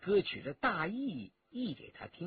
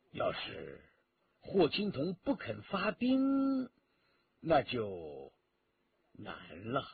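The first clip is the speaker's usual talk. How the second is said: sighing worry; 6 semitones lower, slower than usual. A narrator's reading. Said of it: "徐天红叹了口气". (叹着气低声说，忧心忡忡)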